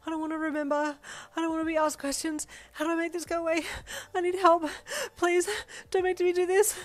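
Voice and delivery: frantic, tearful babble; sobbing panic. Acted. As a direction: beg through a full panic attack. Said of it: "sobbing uncontrollably as terror seized you".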